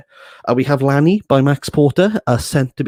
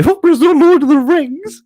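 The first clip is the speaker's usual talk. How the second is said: high, louder mock voice of the author; mocking imitation; 7 dB louder than usual. Mocking the author's excuse, high and loud. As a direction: parody his excuse in a silly high voice. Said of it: "and then tried to reflect away".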